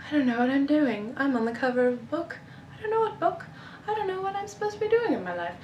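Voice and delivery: mock dopey high voice for the cover character; voicing clueless cover figure. Mocking the cover figure's thoughts in a dopey high voice. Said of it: "they just look really, like".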